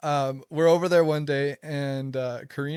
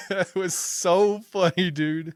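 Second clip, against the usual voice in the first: real laughter, louder and higher, slowed; laughing; 5 semitones higher, 5 dB louder, slower than usual. Real, not acted. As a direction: laugh through the words, delighted. Laughing at the retold story.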